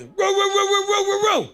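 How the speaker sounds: loud high barking "row row row"; shouted dog bark imitation. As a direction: bark like a big angry dog.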